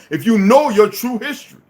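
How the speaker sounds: ordinary delivery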